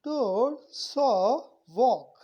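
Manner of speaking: 'डोर, सॉ, वॉक' loudly with a long open ओ; teacher modelling 'सॉ' loudly; long o demo. Shown as a demonstration. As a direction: stretch the ओ in 'वॉक'. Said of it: "ये बड़ा ओ है".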